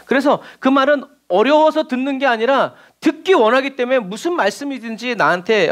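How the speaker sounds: ordinary talk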